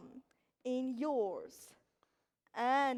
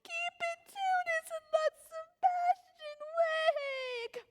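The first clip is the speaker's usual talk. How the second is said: crying-out voice; 4 dB louder than usual. Acted. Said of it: "seems to cry out".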